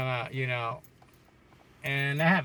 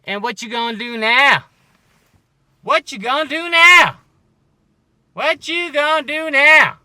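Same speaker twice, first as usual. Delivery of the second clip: high yell, louder on the repeat; taunting shout; 12 semitones higher, 16 dB louder than usual. Real, not acted. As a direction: holler the taunt at full volume. Climax of the rant, taunting.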